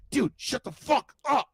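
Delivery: high, mock-irritated cartoon voice; Cartman. Cartoon boy silencing the old man, mock irritation. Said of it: "And Cartman's like".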